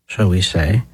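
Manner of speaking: ordinary delivery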